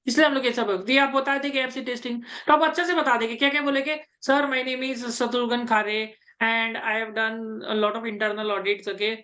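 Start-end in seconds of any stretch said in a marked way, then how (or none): 4.3-9.2 slow, measured, lower-pitched mock candidate; a tutor demonstrating a calm, very slow self-introduction for an audit interview; slow interview answer. Shown as a demonstration. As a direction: recite it slowly and deliberately, as if facing the interviewer. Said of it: "तो ऐसे करके आपको उसको बोलना है".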